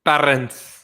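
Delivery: Parents with a rolled, rounded r; Parents, as the host hears it; his way of saying parents. Shown as a demonstration. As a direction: say Parents his own way, drawing out the r. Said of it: "I love the way how you say parents".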